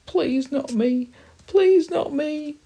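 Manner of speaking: very high, slow, loud squeal; pleading cry. Acted. Guinea pig begging not to be eaten, crying. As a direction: beg in terror, drawing it out. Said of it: "cries the guinea pig".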